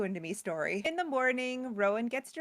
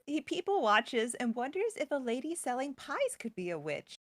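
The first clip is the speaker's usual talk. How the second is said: usual speaking voice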